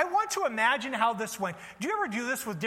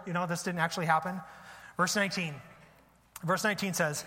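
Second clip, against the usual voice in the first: ordinary talk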